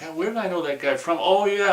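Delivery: an oh-yeah moment of realizing; surprised recognition. Retold or imitated. Acting out recognizing the actor.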